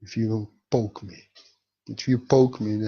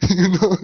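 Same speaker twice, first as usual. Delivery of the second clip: high, loud burst of laughter; gleeful cackling laugh over you know; demo heavenly laughter; 6 semitones higher, 7 dB louder than usual. Shown as a demonstration. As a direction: laugh out like the angels. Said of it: "laughing like this".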